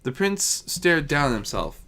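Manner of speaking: plain reading voice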